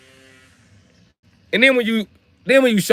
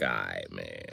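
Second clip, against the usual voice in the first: low, soft giving-up; resigned; 15 dB quieter, slower than usual. Retold or imitated. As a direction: drop the voice and concede. Gives up arguing.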